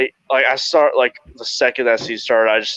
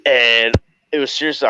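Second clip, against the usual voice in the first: usual speaking voice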